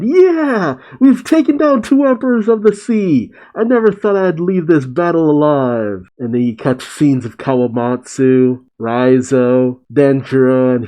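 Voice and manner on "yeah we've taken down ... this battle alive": much higher, jubilant cheer that settles down; triumphant cry. Retold or imitated. Samurai cheering their victory, a high jubilant cry. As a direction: cheer like soldiers who just won. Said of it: "the samurai crying out".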